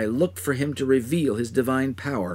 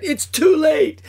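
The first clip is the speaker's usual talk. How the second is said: much higher, louder scream of despair; agonized scream; 7 dB louder, slower than usual. Acted. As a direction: scream it in anguish, rushing out. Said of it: "Screaming in agony".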